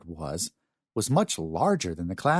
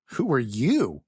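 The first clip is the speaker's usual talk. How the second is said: higher, pointed counter-question with punch on "you"; defensive retort; 4 semitones higher than usual. A narrator's reading. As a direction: throw the question back defensively. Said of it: "Scott looked at him defensively".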